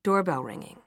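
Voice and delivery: usual speaking voice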